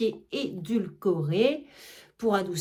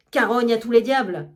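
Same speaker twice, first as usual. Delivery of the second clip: louder furious oath; angry cursing; 6 dB louder than usual. Acted. Argan cursing his absent maid, louder angry oath. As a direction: swear at her like a furious master.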